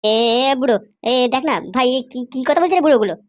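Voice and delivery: low, mocking thug voice; young thug. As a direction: taunt the old man from deep in the chest. Thug rattled by threats. (নিচু গলায় ঠাট্টা করা গুন্ডা)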